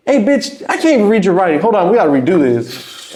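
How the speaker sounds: high exasperated mock rant; mock complaint. Rapper who can't read the lyrics, high mock exasperation.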